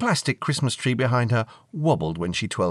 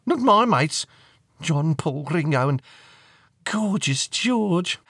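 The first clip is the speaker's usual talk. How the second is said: high, loud, then slowing dreamily; teenage fan correcting her parents; starstruck teenage girl; 7 semitones higher, 4 dB louder, slower than usual. Acted. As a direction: swoon over the last name.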